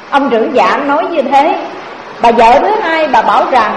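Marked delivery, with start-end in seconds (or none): none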